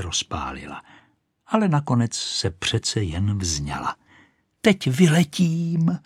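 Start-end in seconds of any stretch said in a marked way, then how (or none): 4.6-6.0 high, slow triumphant cry; high storybook shout. A narrator's reading. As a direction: announce take-off, stretched out. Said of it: "křičela".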